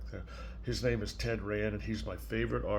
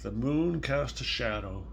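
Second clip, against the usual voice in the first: put-on reading to a child; 4 dB quieter than usual. Grandparent reading Salt Hands to a small child, gentle storybook voice. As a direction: read it softly, like a bedtime story. Reads picture book aloud.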